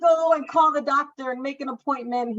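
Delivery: high, loud, pressing husband; husband urging a doctor visit, high and loud; insistent husband. Retold or imitated.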